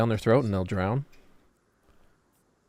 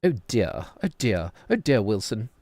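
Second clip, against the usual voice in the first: swooping posh British lament; mock British accent. Streamer parodying how British people talk, fussy British accent. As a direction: fret like a posh Brit. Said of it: "That's how British people talk".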